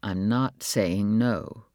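very slow, low, guarded; slow, careful. A narrator's reading. Husband hedging with his wife, slow. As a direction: stretch it out, hesitant.

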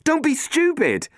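slow, high and loud rebuke; angry protest. Acted. Matt rebuking Robin at the controls, angry. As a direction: scold her, drawn out.

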